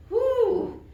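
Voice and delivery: high, happy cheer; excited whoop. Real, not acted. Teacher cheering the class through side stretches, excited whoop.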